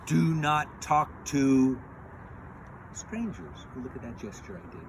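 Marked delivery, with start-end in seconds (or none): 0.1-3.7 loud, slow, deliberate motherly warning; mother's warning. Acted. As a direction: spell out the rule, pausing before the last word. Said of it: "She says".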